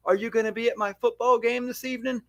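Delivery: put-on high, soft pleading kid voice; movie child. Child asking departing parent about the game, hopeful high voice. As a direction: ask softly in a small, high child's voice. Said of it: "a mom or a child".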